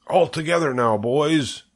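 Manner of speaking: rally the crew to heave; rallying call. A narrator's reading. Farmer to men lifting crate, rallying.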